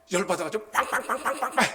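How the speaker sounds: high, loud 빵빵빵빵빵빵 like a blaring car horn, with a laugh; laughing horn imitation. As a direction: lean on the horn in mock fury. Imitating angry horn honking.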